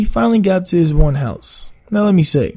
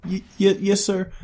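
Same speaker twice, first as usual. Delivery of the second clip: scared stuttering mumble; 4 semitones higher, 4 dB quieter than usual. Retold or imitated.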